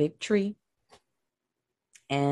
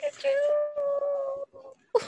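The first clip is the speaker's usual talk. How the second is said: doting coo; much slower than usual. Retold or imitated. Mother cooing at her son's British act, very high. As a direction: coo at him like a proud mom. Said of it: "I'm like".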